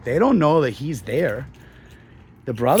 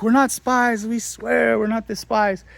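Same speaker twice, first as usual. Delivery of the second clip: pleading brothers; 7 semitones higher than usual. Retold or imitated. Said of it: "they're like".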